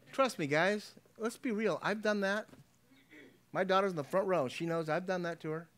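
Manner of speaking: normal talking voice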